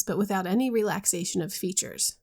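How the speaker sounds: usual speaking voice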